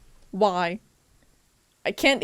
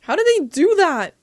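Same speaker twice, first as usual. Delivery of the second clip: high, louder burst of disbelief; astonished outcry; 7 semitones higher, 6 dB louder than usual. Real, not acted. Streamer reacting to the game in amazement, astonished. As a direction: blurt it out, pitch jumping up.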